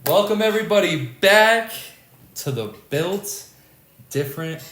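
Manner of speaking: loud, high hype welcome; podcast host intro. Acted. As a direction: pump up the audience. Podcast opening.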